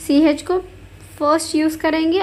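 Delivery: ordinary talk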